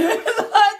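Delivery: high sudden laugh; burst of laughter. Real, not acted. Reader laughing mid-reading. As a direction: laugh out loud at the joke. Laughing at her own remark.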